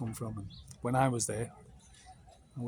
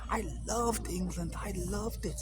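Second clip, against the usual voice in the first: a hint of an old German accent, higher; lightly voicing the old German, higher; old German man; 8 semitones higher, slower than usual. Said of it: "And he said".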